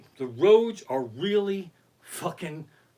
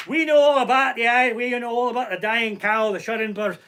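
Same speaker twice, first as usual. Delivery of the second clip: put-on high, loud Irish accent, easing toward the end; put-on Irish cop voice; Irish policeman; 5 semitones higher, 4 dB louder than usual. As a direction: let them in on it as an Irish cop. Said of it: "the Irish cops are".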